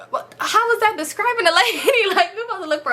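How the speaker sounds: high, laughing question in disbelief. Retold or imitated. Friend laughing at vague description.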